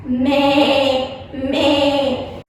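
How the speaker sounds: held imitated "meh" bleats; sheep bleating. Shown as a demonstration. Teacher answering how a sheep sounds, drawn-out bleating. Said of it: "How does the sheep sound?".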